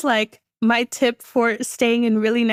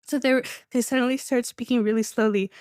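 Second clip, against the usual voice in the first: ordinary talk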